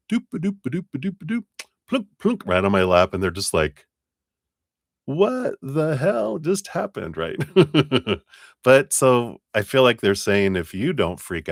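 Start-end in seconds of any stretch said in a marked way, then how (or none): 0.1-2.4 high, fast sing-song pitter-patter; pattering cat noises. Retold or imitated. Imitating a cat trotting over, high and quick. 5.1-7.2 high, slow, baffled disbelief; stunned owner. Retold or imitated. Owners watching their shy cat climb in her lap, high and baffled.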